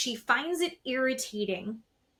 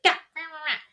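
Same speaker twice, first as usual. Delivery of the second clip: mock screaming gibberish; 5 semitones higher than usual. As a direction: ham up a drunk tirade as noise. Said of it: "Ends up screaming at Kristen".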